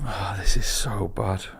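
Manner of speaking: slow, whispered groan of embarrassment; cringing whisper. Real, not acted. Host cringing at his own story, whispered. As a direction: whisper it slowly, mortified.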